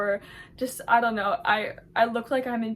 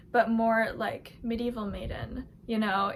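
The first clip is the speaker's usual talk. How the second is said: normal talking voice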